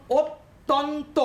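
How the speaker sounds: অত্যন্ত slowly split into three beats, ot-ton-to; অত্যন্ত said slowly in three separate syllables. Shown as a demonstration. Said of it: "তিনটে জায়গা নিয়ে উচ্চারণ করছি".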